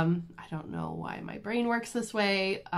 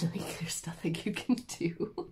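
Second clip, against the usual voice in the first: whispered helplessness; 6 dB quieter than usual. Real, not acted. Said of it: "your mouth is wide open".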